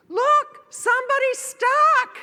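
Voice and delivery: high, loud call, a bit slow; mock distant onlooker yell; calling out from afar. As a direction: shout to others, pointing. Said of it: "somebody way off in the distance".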